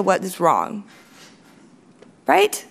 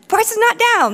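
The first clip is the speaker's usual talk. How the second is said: higher-pitched mock indignation, NOT punched; indignant rebuttal; 9 semitones higher than usual. Engineer contradicting a complaining customer, mock-indignant rebuttal. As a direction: argue back with emphatic denial. Acting out the argument back.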